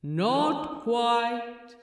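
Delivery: a voice from the heavens; disembodied voice from above. A narrator's reading. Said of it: "said a disembodied voice from above".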